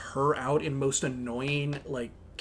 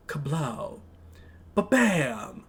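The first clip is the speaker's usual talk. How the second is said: put-on bored English accent; 5 dB louder, much slower than usual. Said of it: "bored English voice going".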